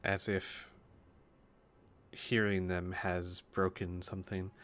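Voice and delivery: ordinary delivery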